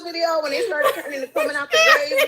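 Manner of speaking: high, loud laughing shout. Real, not acted. Telling a friend about the Thriller comparison, laughing loudly. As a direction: holler it through laughter. Laughing at zombie-like church members.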